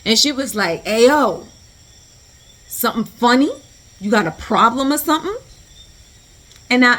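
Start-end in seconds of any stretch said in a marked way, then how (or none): 0.8-5.4 loud, higher, squaring up for a fight; confrontational challenge. Retold or imitated. Pregnant stranger challenges the staring women, confrontational. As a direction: imitate someone picking a fight, pitched up. Confrontational woman voiced in story.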